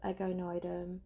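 normal talking voice

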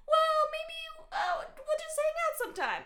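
squeaky high, sing-song creep voice; hammed-up pest brushing off a no, very high; high creepy man. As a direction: pitch it way up like a smarmy stranger. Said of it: "he's like".

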